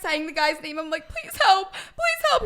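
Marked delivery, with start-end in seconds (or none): 1.1-2.4 high, squealing cry for help with laughter creeping in; mock screamed plea. Begging the guy for help in the retold story, mock screaming. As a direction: imitate a helpless, pained squeal. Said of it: "and I'm screaming".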